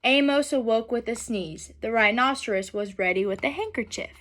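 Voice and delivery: gentle, unhurried storybook reading; reading to children. A narrator's reading. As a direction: read it aloud to a class of kids.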